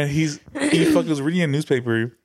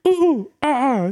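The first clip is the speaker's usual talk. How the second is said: high, loud monkey hoots; monkey calls, loud; 9 semitones higher, much faster than usual. Retold or imitated. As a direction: hoot up high like an ape. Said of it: "we was like".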